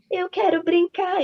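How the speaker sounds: high, slow imitation of a whining daughter; whining child. Daughter refusing to leave the mall, mock childish whine. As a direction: drag it out high like an insisting child. Child's tantrum voiced by mother.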